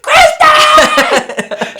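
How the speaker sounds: very high, loud call; imitated alarmed scream. Mom calling after her daughter leaping out, mimicked scream. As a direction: mimic a mother shrieking after someone.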